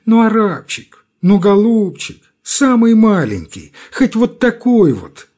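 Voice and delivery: high, pleading whine; begging whine. A narrator's reading. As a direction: wheedle for a crumb. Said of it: "подлым нищенским тоном".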